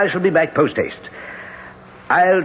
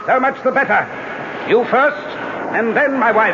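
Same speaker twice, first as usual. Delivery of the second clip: high, heated threat; menacing threat; 7 semitones higher than usual. Acted. Husband threatens lover and wife, heated menace. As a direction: promise death to both, voice raised.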